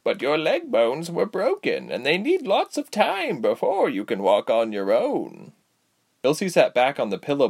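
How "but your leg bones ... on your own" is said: put-on high, slow, swooping old lady voice; lilting aged healer; cautioning old woman. A narrator's reading. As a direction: warn kindly like a granny nursing a patient.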